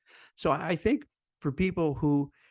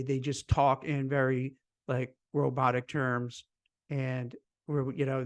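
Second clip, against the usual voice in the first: ordinary talk, nothing marked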